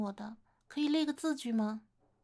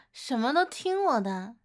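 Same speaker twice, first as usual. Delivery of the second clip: gleeful repeat; 9 dB louder than usual. Acted. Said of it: "你再说一遍".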